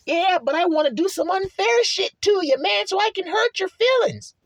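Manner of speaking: very high mock whine; spiteful antagonist. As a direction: play the opponent in a squealing, put-on voice. Acted voice of spiteful opponent.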